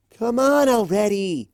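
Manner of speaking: high, loud impatient jeer; heckling crowd. Acted. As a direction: hurry her up, annoyed.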